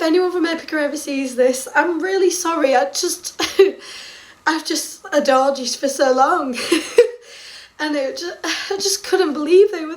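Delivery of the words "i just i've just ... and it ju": usual speaking voice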